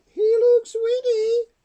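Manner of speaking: very high, loud wailing complaint; grumbling mother dinosaur. A narrator's reading. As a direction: squawk out a horrified grumble. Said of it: "And she grumbled".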